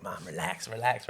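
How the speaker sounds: low, hushed plea to mom; calming aside. Retold or imitated. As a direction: settle her down under your breath. Son calming angry mother.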